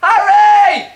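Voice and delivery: a high, loud shout for Harry; calling out. Acted. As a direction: yell his name up from the cellar. Calling out from the cellar.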